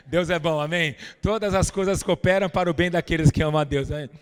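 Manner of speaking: loud full-force sermon proclamation; preaching. Acted. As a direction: proclaim it to the congregation. Pregação em força total. (pregação em voz alta e forte)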